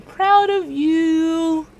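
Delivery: high, very slow, sing-song praise; drawn-out affirmation. Shown as a demonstration. Host leading viewers in self-praise, high and drawn out. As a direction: stretch it out high, savouring each word.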